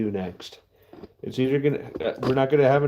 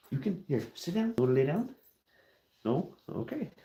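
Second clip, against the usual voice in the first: pet talk; 5 semitones higher, 7 dB quieter than usual. Real, not acted. Settling the dog down.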